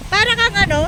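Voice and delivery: ordinary talk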